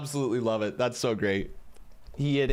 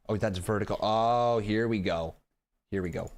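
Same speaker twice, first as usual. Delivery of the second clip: ordinary talk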